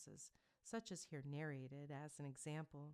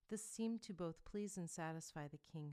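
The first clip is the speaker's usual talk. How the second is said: plain reading voice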